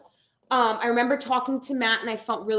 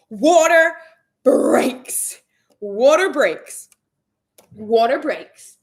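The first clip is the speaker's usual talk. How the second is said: dramatic announcement; 7 dB louder, much slower than usual. Retold or imitated. Retelling the water breaking.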